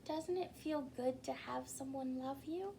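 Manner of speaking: put-on soft question to children; hushed heartfelt aside; tender aside. A narrator's reading. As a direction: gently ask the little ones. Narrator asks children softly.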